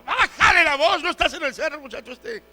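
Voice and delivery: high mock scolding, loud then trailing off; exasperated mom voice, starting loud; scolding mother. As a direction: imitate mom cutting off a chattering boy. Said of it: "mi mamá me decía de chico".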